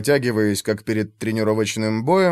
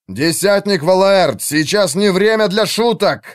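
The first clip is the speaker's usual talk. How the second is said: raised, irate rebuke; angry growl; 6 semitones higher, 4 dB louder than usual. A narrator's reading. Commander rebukes archer for missing, angry growl. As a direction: reprimand a subordinate through anger. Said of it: "зло прорычал Артур".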